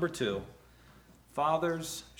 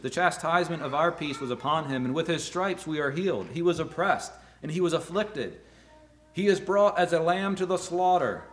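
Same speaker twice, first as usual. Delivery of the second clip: raised, fervent reading of scripture; preacher reading Isaiah to the congregation, fervent; 2 semitones higher, 5 dB louder than usual. Acted. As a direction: proclaim the passage with rising force. Reads Isaiah 53 aloud.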